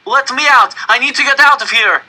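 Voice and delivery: high, loud, frantic cry; trapped man screaming at the car walls; panicked scream. Acted. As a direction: scream to be let out. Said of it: "started to scream".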